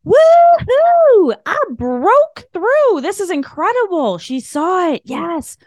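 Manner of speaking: a spirit cheering that its sign got through; thrilled spirit. Pretend. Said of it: "spirit self would be like".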